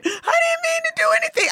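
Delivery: high, fast whiny fake sob; mock crying. Imitating the crying woman at the airport, high whiny sob. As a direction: play the fragile crier. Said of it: "They start crying".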